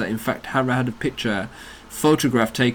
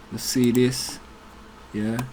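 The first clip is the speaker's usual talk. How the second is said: usual speaking voice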